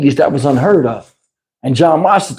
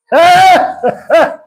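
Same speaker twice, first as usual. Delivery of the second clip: high burst of laughter; laughing; 10 semitones higher, 4 dB louder than usual. Real, not acted. Storyteller cracks up over his friend's fall, laughing.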